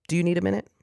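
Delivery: put-on a mother offering space to her crying child; lightly soothing; gentle mom voice. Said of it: "I would just go".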